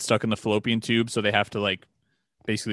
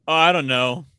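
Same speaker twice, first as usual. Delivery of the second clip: mock clueless shrug, higher and louder; clueless quote; 4 semitones higher, 5 dB louder than usual. Podcaster mimics a co-host who knows nothing, clueless. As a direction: play someone who couldn't care to learn. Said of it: "instead of just being like".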